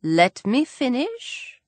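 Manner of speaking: slow it right down, firmly; stern. A narrator's reading. Father cutting off his daughter's interruption, slow and stern. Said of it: "said Mister Bennet sternly".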